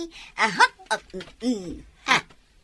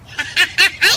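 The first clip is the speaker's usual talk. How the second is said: gleeful laugh; 2 semitones higher, 4 dB louder than usual. Acted. Gleeful after winning money.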